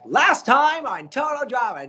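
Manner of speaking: big, high announcer intro; big show intro. Shown as a demonstration. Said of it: "I'd do a big intro".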